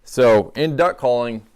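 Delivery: normal talking voice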